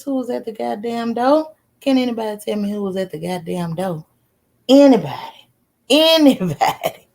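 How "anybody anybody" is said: loud, demanding call to the chat; loud challenge to the viewers. Real, not acted. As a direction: shout it out, wanting an answer. Calling out to chat.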